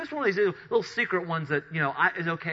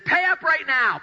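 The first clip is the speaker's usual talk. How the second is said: shouted demand, higher and louder; angry demand; 10 semitones higher, 6 dB louder, slower than usual. Acted. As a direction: bark it at the debtor like a furious creditor. Creditor shaking debtor for money.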